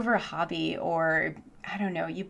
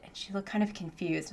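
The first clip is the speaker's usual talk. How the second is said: whispered aside; 7 dB quieter than usual. Real, not acted. Whispering to viewers that the student looked confused. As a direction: drop to a breathy whisper.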